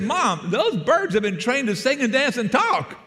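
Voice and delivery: high, drawn-out mock protest; dismayed son. Exasperated son protests to mother.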